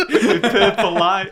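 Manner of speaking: laughing. Real, not acted. Joking through laughter.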